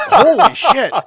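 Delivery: loud, high laughing exclamation; laughing disbelief. Retold or imitated. Reacting to the imitated voice.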